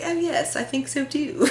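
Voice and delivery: high-pitched British reply, softly put on; British accent. Niece answers her aunt, mock British accent. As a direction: imitate a niece doing British. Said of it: "a British accent with".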